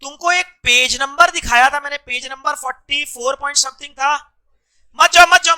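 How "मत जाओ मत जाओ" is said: loud, high, urgent plea to stay; raised-voice repeated command; calling out. Real, not acted. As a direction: call out to stop someone going. Calling out to stop someone leaving.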